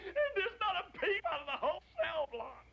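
high voice breaking with laughter; tickled, high-pitched storytelling; laughing while telling. Acted. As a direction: struggle to get the joke out through giggles. Laughing through his own joke.

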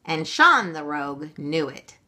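ordinary delivery, nothing marked

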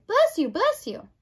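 high, loud blessing; hollered, pitch jumping up; shouted. Acted. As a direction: shout it brightly after a sneeze. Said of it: "shouted Meg".

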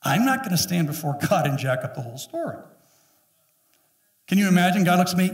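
normal talking voice

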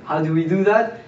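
ordinary delivery

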